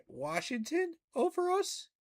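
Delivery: can't believe what he's reading, pitch spiking high; incredulous outburst. Real, not acted. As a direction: voice shoots way up in disbelief. Outraged at ESPN ranking his team.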